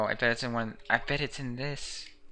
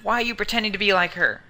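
loud, higher angry shout; furious denial; 8 semitones higher, 9 dB louder than usual. Acted. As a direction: yell the accusation back. Voicing son in game quarrel.

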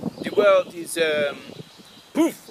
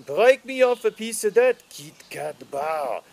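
put-on jingle line in a French accent; mock French accent. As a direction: carry the tune with a French accent.

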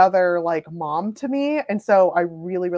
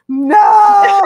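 loud, high mock wail of dismay; horrified shout; 11 semitones higher, 14 dB louder than usual. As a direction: cry out in exaggerated horror. Retelling her horror at the misunderstanding.

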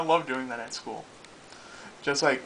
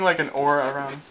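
ordinary talk, nothing marked

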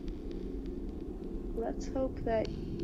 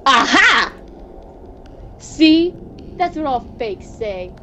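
triumphant aha; 2 semitones higher, 6 dB louder, slower than usual. Acted. Catching his double out, a loud triumphant aha. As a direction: pounce on the slip in triumph.

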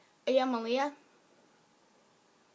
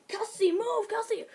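high urgent laughing shout; imitating friend Cassidy; 9 semitones higher than usual. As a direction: play Cassidy shooing her friend aside, laughing. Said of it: "I'm now Cassidy".

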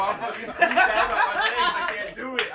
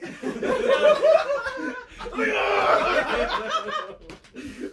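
high laughing fit; 3 dB louder than usual. Real, not acted. Laughing at the video.

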